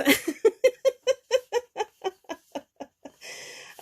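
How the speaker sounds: long, high burst of real laughter. Real, not acted. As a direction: break up laughing at the punchline. Laughing at her own funny story.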